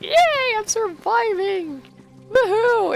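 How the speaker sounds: squealed high, slow mock cheer. Gamer cheering herself on for surviving the fight. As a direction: cheer like a giddy kid who survived. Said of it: "I'm like".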